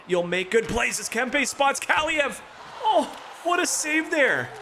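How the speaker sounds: high, slow, drawn-out excited call. Real, not acted. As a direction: rise up as the shot comes. Big scoring chance and save.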